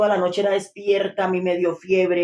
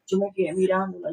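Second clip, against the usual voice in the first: normal talking voice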